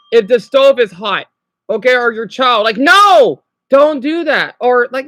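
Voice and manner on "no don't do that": loud and higher, a sharp parental warning; loud scolding no. Retold or imitated. Scolding a child near the hot stove, loud and firm. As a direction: bark it at a child reaching for the stove.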